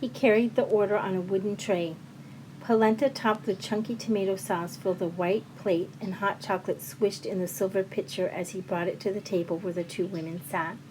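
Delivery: ordinary delivery, nothing marked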